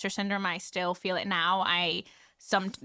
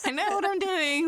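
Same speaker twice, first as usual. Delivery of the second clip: high, put-on confident tone; high, faux-breezy I know what I'm doing; mock confidence; 11 semitones higher than usual. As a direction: fake being sure of yourself, pitched up high. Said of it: "I'm just like".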